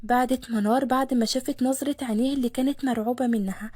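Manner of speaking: plain reading voice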